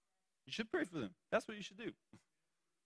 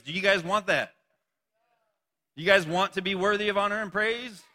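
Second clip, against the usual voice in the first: usual speaking voice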